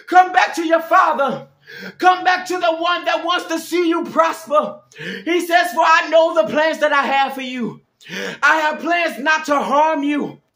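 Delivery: high, loud, urgent preaching cadence; full-force preaching. Real, not acted. Preacher pleading with listeners to come back to God, impassioned preaching. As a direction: plead with the congregation from the pulpit.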